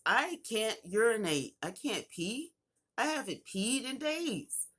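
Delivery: dismayed realisation. Retold or imitated. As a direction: let the retold alarm lift your pitch. Sudden realisation she cannot give sample.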